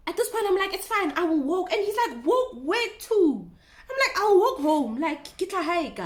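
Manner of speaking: high, loud agitated retelling. Real, not acted. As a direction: talk fast and heated. Heated exchange, voice raised.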